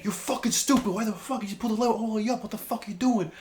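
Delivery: high-pitched imitation of a scolding; angry yelling. Dad yelling at the new guy over the lever, angry. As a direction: mimic an exasperated boss telling off a worker. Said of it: "yelling angry, yo".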